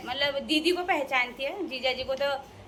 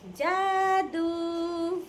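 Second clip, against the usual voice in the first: drawn-out jaadu; 3 semitones higher, much slower than usual. Acted. Teasing the brother-in-law with a mock magic word, slowly stretched. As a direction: stretch the word जादू very long and slow, like a mock spell.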